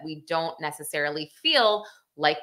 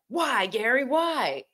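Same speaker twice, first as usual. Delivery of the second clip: higher-pitched imitation of an officer's question; quoted officer's outcry; 6 semitones higher than usual. Officer to Gary after the shooting, higher-pitched quoted outcry. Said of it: "one of the officers who knew".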